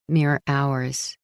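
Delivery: ordinary delivery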